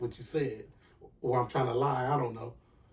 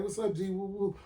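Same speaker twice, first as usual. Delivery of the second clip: higher, softer quoted friend; lightly voiced friend picking up, pitched up; friend answering the phone; 6 semitones higher, 4 dB quieter than usual. Friend answers his call from prison, lightly imitated.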